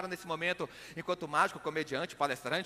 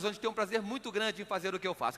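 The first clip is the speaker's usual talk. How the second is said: usual speaking voice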